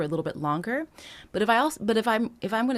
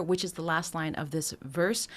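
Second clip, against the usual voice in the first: ordinary talk, nothing marked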